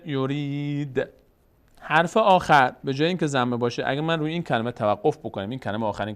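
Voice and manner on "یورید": یورید with a long held "ree" closed on a bare d; final sukun. Shown as a demonstration. Teacher answers what the damma becomes, final sukun. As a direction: stretch یورید and cut the final u.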